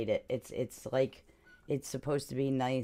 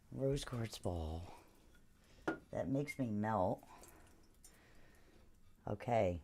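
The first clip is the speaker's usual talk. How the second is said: guided healing voice; 5 dB quieter than usual. Acted. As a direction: ease them gently into focus. Guides a sound healing session.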